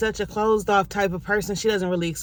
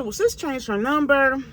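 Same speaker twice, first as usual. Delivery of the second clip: sassy retort; 7 semitones higher, 3 dB louder than usual. Retold or imitated. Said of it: "I said".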